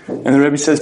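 ordinary talk

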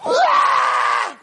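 high, loud acted shriek; sudden piercing kid scream; child's pain scream. Little kid stuck with needle, screaming.

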